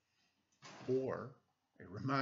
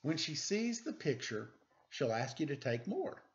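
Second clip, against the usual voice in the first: put-on lively read-aloud for children; storybook reading; 3 semitones higher than usual. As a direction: read it like a bedtime picture book. Reads picture book aloud.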